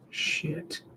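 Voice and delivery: a quick whispered "Shit." to yourself; whispered curse. Real, not acted. Annoyed at stumbling while reading aloud, whispered curse. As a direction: swear under your breath, voiceless.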